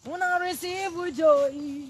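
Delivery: high, loud joyful praise cry to her followers; loud praise shout. Real, not acted. Vlogger rallies her Tito family, loud high praise shout. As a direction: belt out the praise like a revival call.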